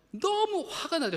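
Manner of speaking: slow, high and loud burst of mock anger. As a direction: blurt out the frustration. Describing anger. (높고 크게 터뜨리는 흉내낸 분노)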